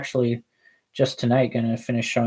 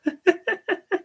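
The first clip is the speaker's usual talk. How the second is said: a high, squeaky burst of laughter; short high-pitched cackle; high giggle; 3 dB quieter than usual. Real, not acted. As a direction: giggle at your own joke, way up high. Laughs at own joke.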